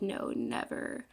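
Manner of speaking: slow breathy menacing whisper of the men; drawn-out sinister whisper, mock-creepy; creepy refusal. Creepy men answering her at dance.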